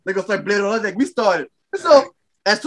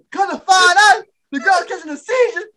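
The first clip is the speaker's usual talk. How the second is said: laughing hard; 8 semitones higher than usual. Real, not acted. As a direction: tell it while laughing hysterically. Teller laughing through story.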